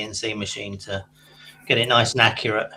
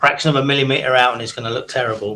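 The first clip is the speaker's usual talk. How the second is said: ordinary talk, nothing marked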